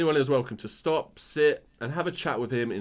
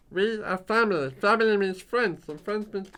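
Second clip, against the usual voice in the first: Stitch voice; 9 semitones higher than usual. Retold or imitated. Co-host asks him to do Stitch, high alien voice. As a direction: squeeze it up into a little alien creature. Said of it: "Can you do a Stitch impression?".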